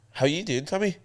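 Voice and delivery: put-on Northern Irish accent, Tommy lifted high like a question; Northern Irish question lilt. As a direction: end on a steep rise, Northern Irish style. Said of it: "sounds like a question".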